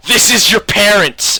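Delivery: loud, high retold yell; mock shout. Dad shaking the bag at baby squirrels, mock shouting. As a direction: call it up at a tree, hammed-up and loud.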